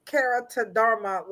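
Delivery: usual speaking voice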